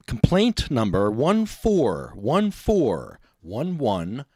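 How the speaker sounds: measured, slow complaint; slow and deliberate. Real, not acted. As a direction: spell it out slowly for the record.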